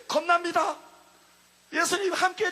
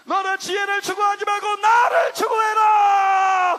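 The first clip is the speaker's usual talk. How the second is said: slow, much louder proclaiming command; preaching shout; 4 semitones higher, 9 dB louder, slower than usual. Acted. The preacher proclaims the command to the congregation, shouting slowly. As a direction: thunder it out slowly to the crowd. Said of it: "preaching climax".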